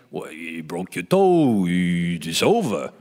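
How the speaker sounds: low, very slow imitated verdict; mock director, drawn-out low voice; Rob Reiner. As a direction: drag it out deep and final. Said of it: "Rob's gonna say".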